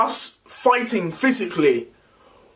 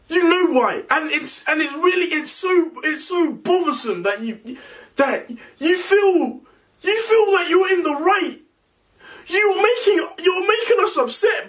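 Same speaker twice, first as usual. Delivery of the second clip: shouting at brother, voice pitched up; heated family shouting; 9 semitones higher than usual. Real, not acted. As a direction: yell it in angry disbelief.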